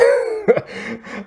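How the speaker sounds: sudden high laugh breaking out; high burst of laughter. Real, not acted. Vlogger laughing at the silly movie plot, high burst of laughter.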